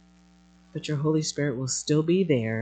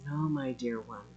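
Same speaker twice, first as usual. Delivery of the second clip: softer, lower, tender reassurance; tender voice of God; 4 semitones lower, 7 dB quieter than usual. Acted. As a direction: gently soothe a dear child as God. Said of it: "you just simply are saying to us".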